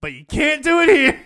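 high, loud mock scolding; retelling a firm order with mock force; telling them off. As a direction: raise it up to lay down the rule. Telling the dudes off.

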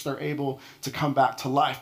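ordinary talk, nothing marked

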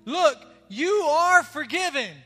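loud, rising preacher's call to the crowd; full-force preaching. Acted. Pastor preaching forgiveness to his church, loud and fervent. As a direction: preach it out to the congregation.

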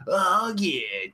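normal talking voice

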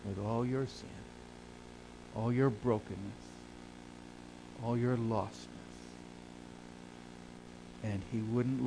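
soft, tender pulpit appeal with long pauses; quiet, heartfelt phrases, left to sink in; solemn, hushed preaching. Real, not acted. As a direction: speak gently and gravely to each listener.

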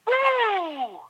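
high drawn-out "woo"; imitated yell. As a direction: imitate a fan's long, high-pitched whoop. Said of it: "kept yelling, like".

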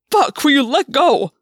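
high, loud, pained plea; voice twisting up. Acted. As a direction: cry out in pain to be released. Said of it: "Gwen's voice twisted up".

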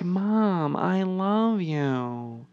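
slow, soft, monotone robot voice; robotic daughter. Retold or imitated. Chelsea tells her mom she loves her, robotic and slow. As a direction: drone it slowly like a robot. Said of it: "killing it with the accents tonight".

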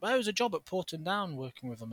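ordinary delivery

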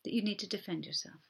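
soft, quick apology; hushed, hurried sorry; apologetic. Acted. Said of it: "So apologise".

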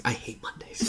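grumpy mutter. Retold or imitated. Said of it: "it's like".

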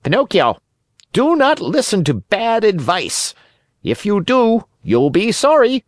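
high-pitched bird calling a warning; urgent little blackbird, high and distinct; sharp, clear blackbird. A narrator's reading. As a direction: call out high and crisp. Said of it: "called out sharp and clear".

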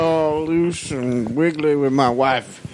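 slow mock country fan; country concertgoer. Friend impersonating a country concert fan, slow comic voice. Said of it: "that demographic does at country concerts".

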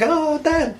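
high-pitched drawn-out cry; mock dismayed kid. Kid spotting his dad in prison, mock dismay. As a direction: imitate a child's plaintive call.

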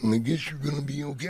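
low, soft wrestler voice; low, grudging wrestler impression; Macho Man. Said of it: "That's a great Macho Man impression".

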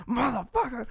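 high, strained mock cursing; acted DJ outburst; DJ losing it. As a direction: pretend to crack off-air. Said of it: "secretly losing his shit".

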